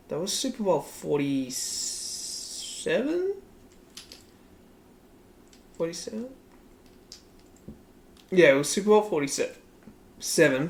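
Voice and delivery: talking with the hiccups; words broken by hiccups. Real, not acted. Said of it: "Sorry, I got the hiccups".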